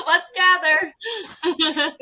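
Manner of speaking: words squeezed out through laughter; laughing. Real, not acted. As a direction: giggle it out slowly. Laughing after pun.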